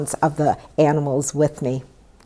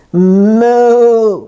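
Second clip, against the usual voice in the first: long, drawn-out moo; cow bellow; 3 semitones higher, 6 dB louder than usual. A narrator's reading. Cow joining the noisy stable, long bellowed moo. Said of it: "bellowed Cow".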